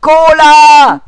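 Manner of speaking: loud high cheer. Real, not acted. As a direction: shout it happily. Enthusiastic answer.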